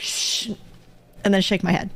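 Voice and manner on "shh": soft shush. Acted. Player character silencing the creature, soft shush. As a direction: hush them gently. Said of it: "I'm just gonna be like".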